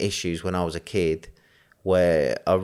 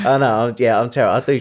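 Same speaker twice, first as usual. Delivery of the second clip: laughing; 7 semitones higher, 8 dB louder than usual. Real, not acted. Laughing at teasing about his accent.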